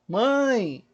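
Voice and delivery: "Mãe" stretched as a classroom demo; prolonged vowel. Shown as a demonstration. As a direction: hold the vowel of "Mãe", then glide off. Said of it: "se você prolongar a palavra".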